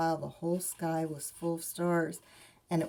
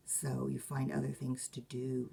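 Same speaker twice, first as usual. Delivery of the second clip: usual speaking voice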